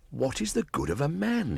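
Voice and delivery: put-on a wild boy puzzling aloud; young Mowgli. Mowgli musing to himself.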